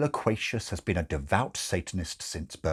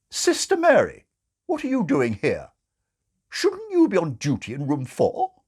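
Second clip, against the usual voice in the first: high, loud bossy matron; matron's scolding; 9 semitones higher, 6 dB louder than usual. Acted. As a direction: call her out sharply as a matron.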